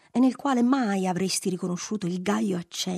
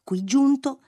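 ordinary delivery, nothing marked

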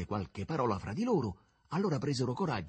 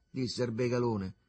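plain reading voice

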